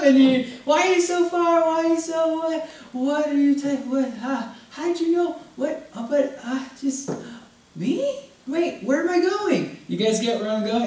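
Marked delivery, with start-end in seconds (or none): 0.6-9.8 mock bewildered. Confused churchgoer before God's throne.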